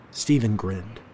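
usual speaking voice

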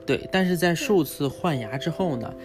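normal talking voice

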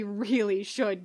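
plain reading voice

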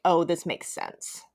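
normal talking voice

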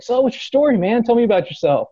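high, chummy retold question; friendly host imitation. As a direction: play an eager host drawing out a guest. Voicing the host's friendly question.

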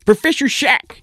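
very high, loud mad scientist voice; squeaky eccentric inventor introduction; mad professor voice. Acted. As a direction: announce yourself like a zany cartoon professor. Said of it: "the man says".

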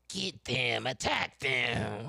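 drawn-out imitation of a drunk cheer; drunk woman. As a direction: stretch the words out like a tipsy heckler. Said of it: "This bitch drunk".

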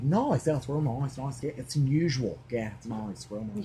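imitating Aussie sitcom banter; hammed-up Australian accent. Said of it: "Your Australian accent is good".

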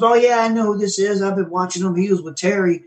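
lightly voiced Heath, upbeat and friendly; imitating Heath. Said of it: "he goes".

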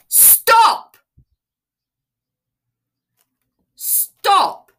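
a commanding yell that stops the inner voice; loud emphatic shout. Shown as a demonstration. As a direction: shout it to cut the thought off dead. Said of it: "loud and emphatically".

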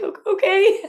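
very high, giggling okay; laughing squeaky okay. Retold or imitated. Retold scared child reply.

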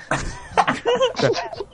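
high unvoiced giggle; high breathless laugh. Real, not acted. Laughs reading comment.

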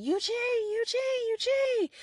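mock yell searching for a friend; calling out. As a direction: call his name across the trees. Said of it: "She's yelling".

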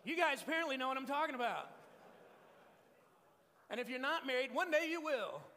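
high, loud shout to the crowd; calling out. Real, not acted. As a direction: holler it over a laughing audience. Calling out over the audience's laughter.

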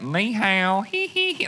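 Ni hao with a high squeaky hee hee hee; mocking the South Carolina guys' botched greeting; mock bad Chinese. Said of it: "That's the way it sounded".

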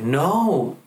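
high, pleading no; a mother's tearful refusal; mom crying no. Retold or imitated. Mom cries, refuses her leaving.

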